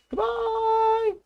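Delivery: high drawn-out goodbye call; chorused farewell. A narrator's reading. The animals calling to the departing van, high farewell. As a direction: sing out a long goodbye. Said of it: "they chorused".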